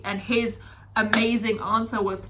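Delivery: ordinary talk, nothing marked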